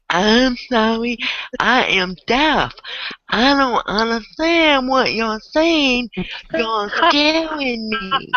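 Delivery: high, slow, exaggerated fake-deaf voice; pretending to be deaf to an angry man, high and slow; playing dumb. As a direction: act deaf and helpless, drawing it out slowly.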